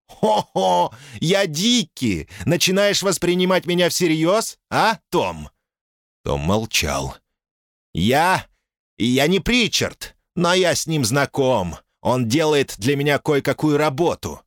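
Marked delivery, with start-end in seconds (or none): 0.1-5.5 put-on high, slow, taunting American accent; mocking American accent. Caller insists he is Dickie. 7.9-14.4 mocking American accent. Caller in Dickie voice.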